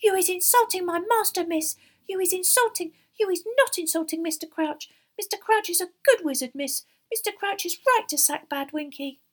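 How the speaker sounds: high, loud house-elf screech; shrill, indignant elf defending her master. A narrator's reading. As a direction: screech in outrage as a tiny elf. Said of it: "and screeched".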